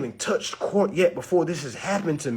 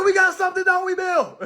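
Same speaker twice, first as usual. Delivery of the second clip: high chummy taunt; buddy up to the officer in mock cheer; mock cheerful cop; 12 semitones higher than usual. To officer Bill, a high mocking chummy voice. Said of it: "I said".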